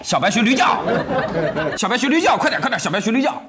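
teasing shout. Acted. Teasing demand, donkey bray.

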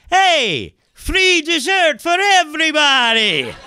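high, drawn-out mock announcement; owner's jubilant announcement. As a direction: call it out to the whole room, high and stretched. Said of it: "and he goes".

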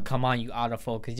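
usual speaking voice

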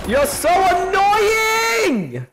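high, slow, stretched-out yell; long high drawn-out shout of annoyance; frustrated shout. Real, not acted. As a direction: wail it in exasperation. Frustrated at enemy player.

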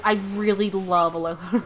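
usual speaking voice

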